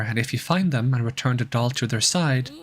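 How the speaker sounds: ordinary talk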